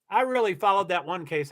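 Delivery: ordinary talk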